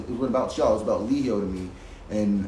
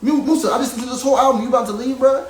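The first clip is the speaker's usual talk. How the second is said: much higher and louder, an angry accusing yell; furious outburst; 12 semitones higher, 6 dB louder than usual. Retold or imitated. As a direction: shout them down as fakes. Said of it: "And I was mad".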